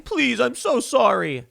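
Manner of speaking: high, slow, hammed-up whimpering sorry; mock sobbing apology. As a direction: fake a tearful grovel, drawn out. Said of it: "sobbing, crying".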